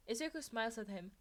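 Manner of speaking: ordinary delivery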